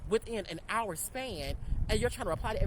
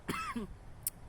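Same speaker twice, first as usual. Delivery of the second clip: cough; 9 dB quieter than usual. Real, not acted. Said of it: "excuse me".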